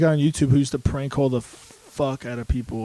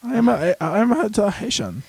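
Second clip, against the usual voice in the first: higher-pitched put-on Filipino accent; mock Filipino accent; 7 semitones higher than usual. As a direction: imitate a small Filipino man's accent. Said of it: "this small Filipino man".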